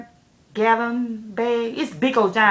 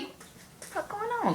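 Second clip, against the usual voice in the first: baffled; 12 semitones higher, 13 dB quieter than usual. Retold or imitated. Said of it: "I'm like".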